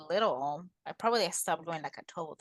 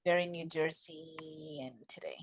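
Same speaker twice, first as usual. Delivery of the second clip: a soft teasing whisper aside; 4 semitones lower, 10 dB quieter than usual. Real, not acted. S1 whispers a teasing aside about her cohost's accent.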